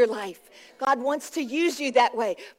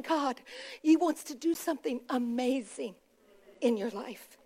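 much softer, with pauses between phrases; hushed preaching; 9 dB quieter than usual. Real, not acted. Preacher assures the congregation of God's plans, hushed. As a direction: bring it right down, almost confiding.

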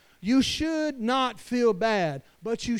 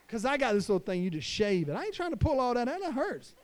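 ordinary talk

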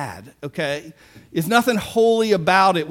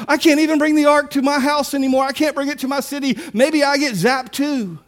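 imitated panic, high and hurried; high-pitched, rushed retelling of a worried man; mock alarmed proclamation; 7 semitones higher, faster than usual. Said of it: "he makes this big proclamation".

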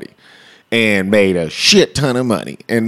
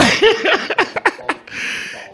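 long high laugh; 4 dB quieter than usual. Real, not acted. Laughs after the quote.

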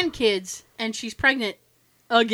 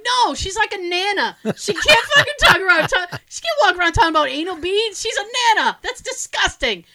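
high-pitched, mortified protest; disgusted outrage; 7 semitones higher, 5 dB louder than usual. Retold or imitated. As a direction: object in appalled disbelief, close to laughing. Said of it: "I was disgusted".

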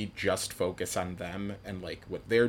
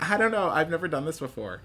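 put-on flustered girl voice; 8 semitones higher, 5 dB louder than usual. Abby's nervous line retold.

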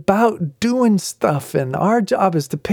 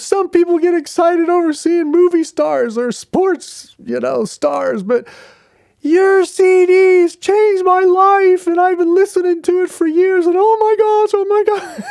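high, louder, gushing over his CDs; gushing fan; 13 semitones higher, 4 dB louder than usual. Retold or imitated. Seventy-year-old woman gushing to Mark about his CDs, excited. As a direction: gush like a starstruck fan.

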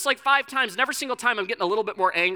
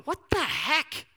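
drawn-out, higher, disbelieving cry to God; bewildered exclamation; 7 semitones higher, slower than usual. Retold or imitated. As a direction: ask it slowly as if baffled. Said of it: "I just go".

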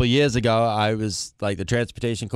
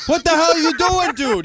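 high-pitched, loud disbelief; exasperated retold yell; 13 semitones higher, 4 dB louder than usual. Coordinator confronting the singer outside the van, exasperated yell. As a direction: act out yelling at someone in sheer disbelief.

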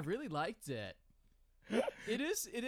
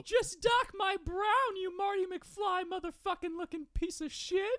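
hammed-up [name]; 13 semitones higher than usual. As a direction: drag it out high and demanding. [name] demands, insulting Adam.

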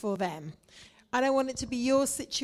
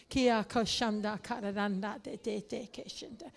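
praying in tongues; 4 dB quieter than usual. Real, not acted. Pastor praying in tongues softly.

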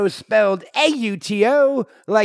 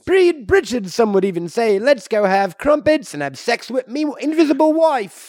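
mock British cheer; 4 semitones higher than usual. British Reed Richards celebrates.

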